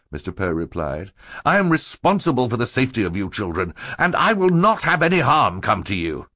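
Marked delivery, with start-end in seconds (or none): 1.4-6.3 rising, emphatic guardian's refusal; firm insistence. A narrator's reading. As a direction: build from firm to high and forceful. Guardian firmly forbids the chase.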